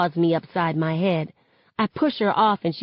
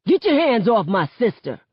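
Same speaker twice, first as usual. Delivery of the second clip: higher-pitched, forceful warning; angry command; 5 semitones higher, 4 dB louder than usual. A narrator's reading. Brother charging in to defend his sister, angry command. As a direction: order him off her angrily.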